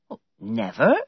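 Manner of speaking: surprised. A narrator's reading. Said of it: "she asked, surprised".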